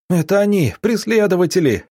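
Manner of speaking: high-pitched alarmed cry, not full volume; wild cry. A narrator's reading. Roman warning that pursuers have come, high wild cry. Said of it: "дико закричал Роман Романыч".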